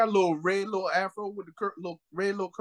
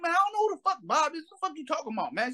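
high mock protest; retold protesting woman; 10 semitones higher than usual. Woman denying she knows Bob, high mock protest. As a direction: imitate her denial in a much higher voice. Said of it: "She like".

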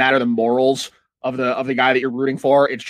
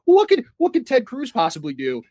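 high, dumb-innocent voice; mocking naive voters; 10 semitones higher than usual. Mocking people defending Ted Cruz, high and naive. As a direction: play it wide-eyed and clueless. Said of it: "people looking at this that are like".

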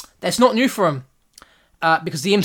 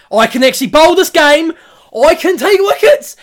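much higher, louder delighted mock voice; mock surprised Leach; 11 semitones higher, 9 dB louder than usual. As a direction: discover you can bowl after all. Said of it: "Leach decides".

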